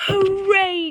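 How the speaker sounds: high joyful cheer. A narrator's reading. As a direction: shout it with delight. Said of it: "cried the scarecrows".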